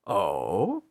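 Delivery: mocking, amused query; drawn-out rising oh through a grin; smug feigned surprise; grinning. A narrator's reading. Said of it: "the king grinned".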